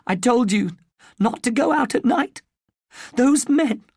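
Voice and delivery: high, loud and tearful; an upset mother on the edge of crying; mother breaking down. Acted. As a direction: voice climbing into tears as she scolds. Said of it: "She starts to cry".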